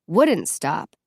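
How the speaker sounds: ordinary delivery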